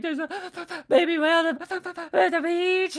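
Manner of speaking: high, loud, sobbing stammer; imitated crying. Tearful caller reporting whale to NOAA, imitated crying. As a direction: blubber through it, words broken by mock sobs. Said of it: "still crying".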